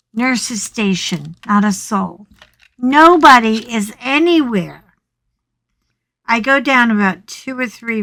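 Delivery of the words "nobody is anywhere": normal talking voice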